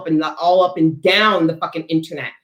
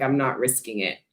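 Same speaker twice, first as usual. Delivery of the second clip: quiet firm refusal; 3 semitones lower, 8 dB quieter than usual. Retold or imitated. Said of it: "It's like".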